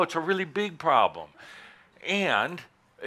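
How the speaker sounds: normal talking voice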